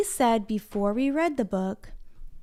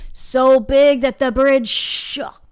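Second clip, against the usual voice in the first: slow, louder storyteller emphasis; dramatic; 3 semitones higher, 8 dB louder, slower than usual. A narrator's reading. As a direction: make the bridge shake with your voice. Dramatic narration.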